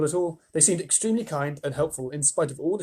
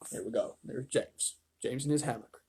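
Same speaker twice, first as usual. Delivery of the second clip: quiet aside; 8 dB quieter than usual. Real, not acted. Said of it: "reader aside to listener".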